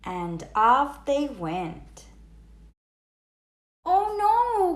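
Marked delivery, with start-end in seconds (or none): 3.8-4.8 high, dismayed frog cry. A narrator's reading. Froggy cries out to his parents in the car, dismayed. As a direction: wail it like a panicked kid frog. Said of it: "cried Froggy".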